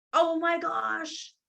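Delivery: high, loud, drawn-out panic; a client losing it, stretched out; frantic panic. Retold or imitated. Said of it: "like, frantic".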